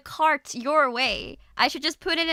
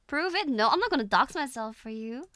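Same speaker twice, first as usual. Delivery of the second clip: ordinary talk, nothing marked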